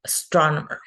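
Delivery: "Astronomer" as one word, second syllable stressed. Shown as a demonstration. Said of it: "stress goes in the second syllable".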